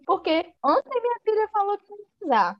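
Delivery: a hint of a bewildered father; baffled father. Mr Bennet confused about his daughter's engagement, baffled. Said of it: "Ele fica assim".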